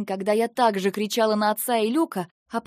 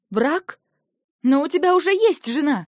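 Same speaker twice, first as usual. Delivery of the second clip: higher and louder, disbelieving cry; shocked outburst; 8 semitones higher, 7 dB louder than usual. Acted. Eva stunned at Lentar's plan to marry her, shocked cry. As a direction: throw out the shock that he already has a wife.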